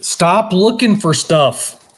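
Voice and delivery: high, slow teasing insistence; slow down and sell it, half-joking; playful insistence. Real, not acted. Urging friend to buy from him.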